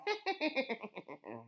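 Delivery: high evil cackle; witch cackle. A narrator's reading. Witch laughs.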